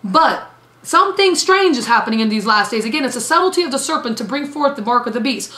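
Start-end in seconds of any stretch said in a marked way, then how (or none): none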